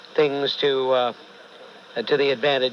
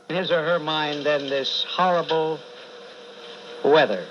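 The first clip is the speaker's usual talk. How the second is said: preaching. Acted. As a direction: preach it with weight. Host turns to preaching about the blizzard.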